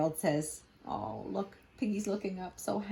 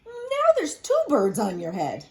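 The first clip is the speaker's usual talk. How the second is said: Piggy voice; 6 semitones higher, 5 dB louder than usual. Acted. Piggy voice in read-aloud.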